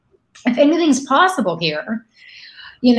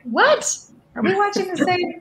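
high-pitched mock bafflement; replayed incredulous reaction, pitched high; retold disbelief; 9 semitones higher than usual. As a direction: act out a baffled "what?", voice shooting up. Acts out own baffled reaction.

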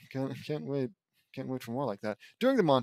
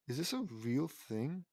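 ordinary delivery, nothing marked

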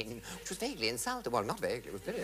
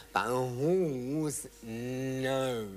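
slow drawn-out line; much slower than usual. Retold or imitated.

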